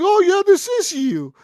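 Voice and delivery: squeaky dismissive mimic; mocking bank manager. Imitating the stubborn bank manager, high and mocking. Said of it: "They're like".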